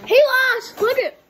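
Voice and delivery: loud, high gloating. Real, not acted. Gloating over the game loss.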